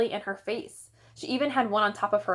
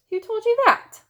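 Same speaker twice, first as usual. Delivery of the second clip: much higher astonished question; surprised dad; 14 semitones higher, 7 dB louder than usual. A narrator's reading. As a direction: ask in disbelief.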